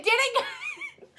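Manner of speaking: high, slow, laughing through it. Real, not acted. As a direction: dissolve into laughter.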